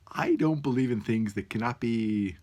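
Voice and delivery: normal talking voice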